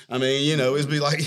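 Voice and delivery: ordinary talk, nothing marked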